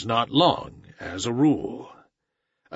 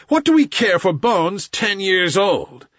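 loud scornful wolves; pack snarling; 6 semitones higher, 5 dB louder than usual. A narrator's reading. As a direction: sneer it at the panther. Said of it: "the pack snarled".

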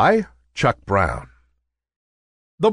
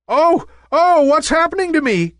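much higher, louder, fast panic; frightened cry; 5 dB louder than usual. A narrator's reading. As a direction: cry out in fear. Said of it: "cried Nimbus in fear".

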